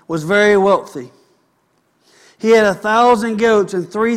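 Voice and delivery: preacher reading First Samuel aloud to his flock; scripture reading. Acted. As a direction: proclaim the passage to the room. Reads scripture aloud to congregation.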